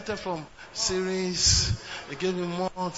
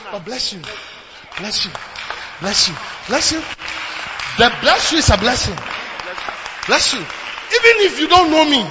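blessing the congregation; 6 dB louder than usual. Acted.

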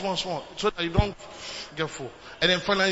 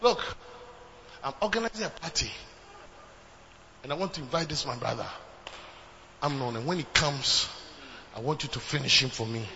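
scheming Absalom; 8 semitones lower, 7 dB quieter than usual. Acted. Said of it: "organized his servants and said".

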